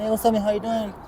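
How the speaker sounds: put-on light and happy hello to a kid; greeting nephew. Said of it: "I'm like".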